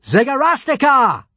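high, loud summons; calling out. A narrator's reading. Hiccup summons help in the arena, calling loudly. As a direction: cry the name out for help. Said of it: "I'll call for".

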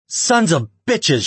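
high, loud, drawn-out curse; angry growl. A narrator's reading. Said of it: "she growled".

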